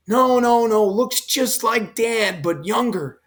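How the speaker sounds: lightly voiced son pressing his point; insistent correction.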